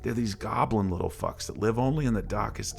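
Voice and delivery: usual speaking voice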